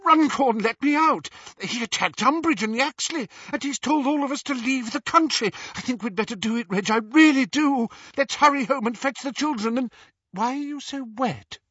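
very high, agitated woman's voice; frantic wife. A narrator's reading. Frightened wife urges her husband to flee, frantic. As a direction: pour it out high and urgent.

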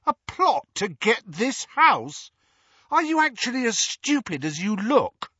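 mock his stupidity; Harry scorning his uncle; scornful. A narrator's reading.

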